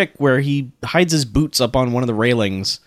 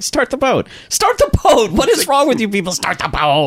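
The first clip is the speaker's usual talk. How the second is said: yelling; 7 semitones higher than usual. Retold or imitated. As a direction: shout it at the crew in a panic. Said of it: "He's like".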